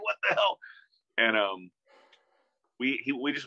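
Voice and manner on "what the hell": high, laughing what the hell; can't believe you're holding his bass; a touch of giddy amazement; stunned disbelief. Retold or imitated. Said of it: "I'm just like".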